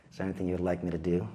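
low, fast mock-dutiful husband; hammed-up eager husband offer; fast low sweet husband imitation. Husband offering wife help.

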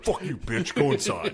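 hammed-up comeback through a laugh; mock retort. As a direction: play the cursing neighbour for laughs. Listener re-acts the retort, laughing.

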